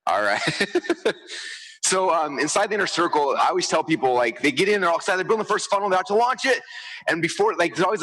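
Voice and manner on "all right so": normal talking voice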